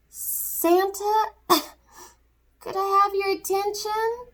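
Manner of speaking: high little elf voice with a cough. Acted. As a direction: squeak up politely like a tiny elf. Edison Elf interrupts Santa.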